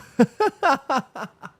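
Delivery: amused high laugh at the comment; sudden high laugh; high burst of laughter. Real, not acted. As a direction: laugh it off, caught out. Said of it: "when [name] gets tired".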